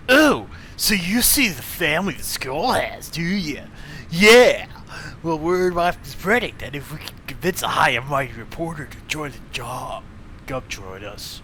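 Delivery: laid-back surfer dude. Acted. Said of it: "making him sound like a surfer".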